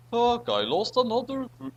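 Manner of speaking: usual speaking voice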